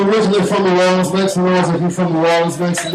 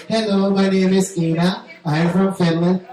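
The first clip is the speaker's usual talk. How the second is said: put-on higher, careful Finnish-accented introduction; Finnish girlfriend accent; 3 semitones higher than usual. Girlfriend greeting his mother, polite Finnish accent. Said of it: "My girlfriend said".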